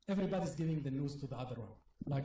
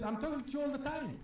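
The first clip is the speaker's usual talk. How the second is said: quick, higher retort from a brushed-off mother; impatient mom; 9 semitones higher, much faster than usual. Retold or imitated. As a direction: rattle it off high and fast like an annoyed mom. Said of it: "She said".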